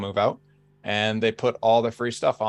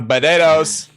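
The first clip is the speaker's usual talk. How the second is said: higher and louder potatoes, bedaydoes; Minion-like potatoes; 6 semitones higher, 10 dB louder, slower than usual. Shown as a demonstration. Teased about Minions: louder, higher Boston potatoes. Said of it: "Are you sure you weren't watching the Minions?".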